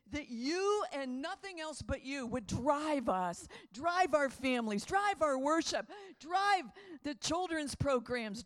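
loud, slow, each "drive" stressed; fervent prayer. Acted. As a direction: pray it out with rising fervour.

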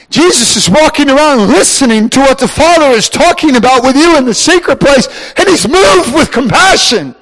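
high, loud fervent preaching; preaching at full force. Real, not acted. Preacher stirring the congregation, preaching at full force. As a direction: build the fervour and lift the whole room.

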